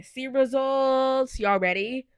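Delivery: drawn-out eager reveal; excited. Real, not acted. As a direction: build suspense as you click. Eagerly clicks to results.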